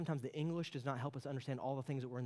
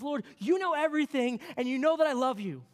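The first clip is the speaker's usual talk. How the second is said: higher, louder, earnest plea; Peter's broken declaration; 10 semitones higher, 8 dB louder than usual. Acted. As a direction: Peter pleading with all he has. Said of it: "he says".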